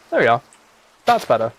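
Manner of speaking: ordinary talk, nothing marked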